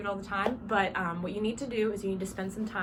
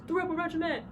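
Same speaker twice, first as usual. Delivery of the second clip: band's response; 9 semitones higher than usual. Shown as a demonstration. Band answers the drum majors' call, chanted response. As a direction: chant it back high like a crowd. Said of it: "And the band says".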